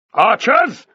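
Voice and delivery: high, very loud military bellow; bellowed command. A narrator's reading. Fortis calls the archers on Spartacus, bellowing. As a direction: bellow it to summon armed men. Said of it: "bellowed Fortis".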